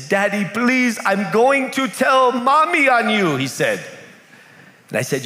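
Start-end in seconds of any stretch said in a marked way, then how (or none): 0.1-3.4 put-on higher, whiny little-boy threat; tattling child. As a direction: threaten like a scared five-year-old.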